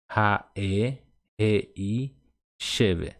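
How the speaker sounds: "Ra, e, re, i, cheve" piece by piece with pauses; separated syllables. Shown as a demonstration. Teacher models the whole phrase for learners, syllables split apart. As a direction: leave a gap after each part of "Ra, e, re, i, cheve". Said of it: "reparem que a pronúncia seria".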